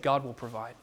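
softly spoken climax of the sermon; hushed reverent quote. Acted. Climactic quote of Abraham's faith.

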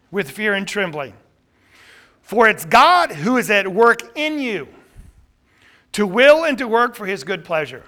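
loud, fervent sermon delivery; preaching. Real, not acted. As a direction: preach it out with full force. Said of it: "I see a sermon coming on right now".

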